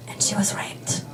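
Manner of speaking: hushed, unvoiced whisper. Real, not acted. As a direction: drop to a whisper for the dark part. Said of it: "hushed grave detail".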